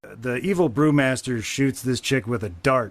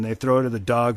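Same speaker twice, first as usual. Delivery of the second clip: ordinary talk, nothing marked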